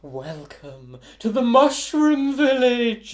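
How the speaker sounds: high, loud, drawn-out gnome proclamation; welcoming gnome. Acted. Game master voices greeting gnome.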